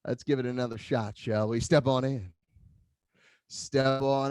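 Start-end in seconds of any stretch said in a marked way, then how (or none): none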